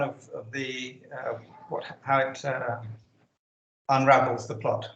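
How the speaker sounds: connection dropping, words fade out; patchy line, volume sinks then returns; uneven volume. Real, not acted. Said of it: "I think there's something going on".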